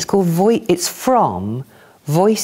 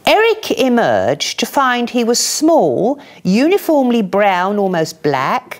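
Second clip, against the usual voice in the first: slightly raised, measured reading voice; poetry reading; 7 semitones higher than usual. Acted. Reader starting a poem about reincarnation, measured reading. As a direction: tell it like a gentle fable.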